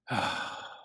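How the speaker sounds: long soft unvoiced sigh; weary sigh. Jesus weary at the mess below, soft sigh. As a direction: let out a tired sigh. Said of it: "hear Jesus sigh".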